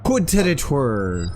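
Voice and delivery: slow, deliberate fake French Coup de territoire; mock French. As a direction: savor the fake phrase. Fake French phrase, slower.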